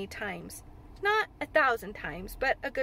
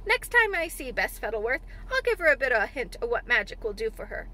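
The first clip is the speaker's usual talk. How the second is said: animated rustic dialect, pushed a little louder; amused old gardener; 4 dB louder than usual. Acted. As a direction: relish the plan like a shrewd old countryman.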